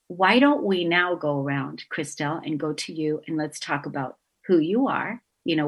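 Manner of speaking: ordinary talk, nothing marked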